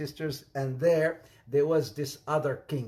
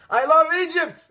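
exclaiming; 11 semitones higher, 10 dB louder than usual. Real, not acted. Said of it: "exclaims love for Egypt".

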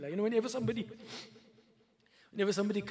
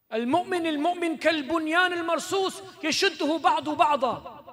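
raised, quick chanted recitation; hadith recitation; 7 semitones higher, 6 dB louder, faster than usual. Acted. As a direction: recite it fast and ringing.

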